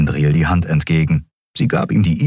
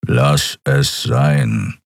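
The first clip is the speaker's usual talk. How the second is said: low, very slow creature growl; deep brute voice, drawn out; growled warning; 4 semitones lower, much slower than usual. A narrator's reading. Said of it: "knurrte er".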